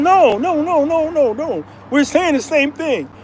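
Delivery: high, hurried protest; desperate to be understood; exasperated. Retold or imitated. Frustrated explaining to waitress.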